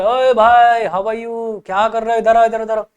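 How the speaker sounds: cheerful shout to a distant friend; calling out. Retold or imitated. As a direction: hail a buddy across the room.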